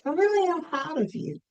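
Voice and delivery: mother's way of talking. Retold or imitated. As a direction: gently imitate a mother pausing before praise. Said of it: "that's how she talked".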